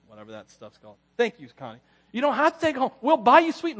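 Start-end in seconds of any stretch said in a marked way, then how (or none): 2.1-3.8 higher and louder, building; exasperated plea. Retold or imitated. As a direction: plead harder with her, losing patience. Pleading with grandma, rising.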